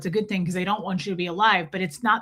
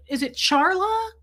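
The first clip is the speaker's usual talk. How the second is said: normal talking voice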